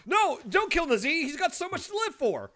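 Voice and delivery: high, loud hammed-up plea to spare him; mock panicked shout. Streamer begging the game not to kill Nazee, mock shouting. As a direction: yell in pretend horror.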